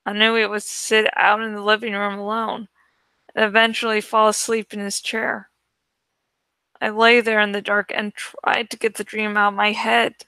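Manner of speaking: ordinary delivery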